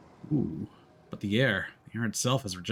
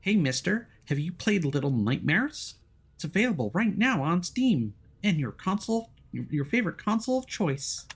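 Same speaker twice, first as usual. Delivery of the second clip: put-on high childish voice; small boy voice; 8 semitones higher than usual. Boy teasing the hero about a game, high kid voice. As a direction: play a little kid being cheeky.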